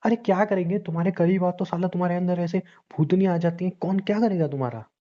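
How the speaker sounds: high-pitched, heated rant at a partner; exasperated outburst. Acted. Man losing patience with his troubled partner, exasperated. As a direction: lash out in frustration, pitch up. Man's outburst, then apologises for it.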